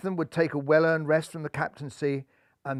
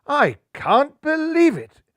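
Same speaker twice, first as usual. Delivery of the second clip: much higher, louder, slow I can't believe it; shocked exclamation; 11 semitones higher, 7 dB louder, slower than usual. Acted. Skipper finding the stowaway, loud shocked exclamation. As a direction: burst out in disbelief. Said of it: "gasped a shocked Golden Hair".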